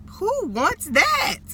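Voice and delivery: high, slow, half-laughing "who wants that"; incredulous. Real, not acted. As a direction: toss it off in disbelief. Mocking her own bad example.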